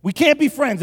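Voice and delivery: high, loud, indignant verdict; mock outrage. Pastor disowning his cereal-bungling buddy, mock outrage. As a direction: cry it out in pretend outrage.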